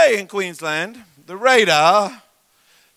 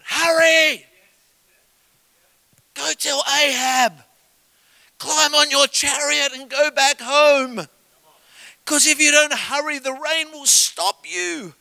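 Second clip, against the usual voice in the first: higher, slowed urgent call; shouted urgency; 9 semitones higher than usual. Retold or imitated. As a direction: urge them to hurry home before the storm. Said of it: "he shouted".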